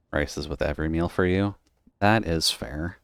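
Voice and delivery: usual speaking voice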